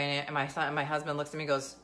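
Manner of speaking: ordinary talk, nothing marked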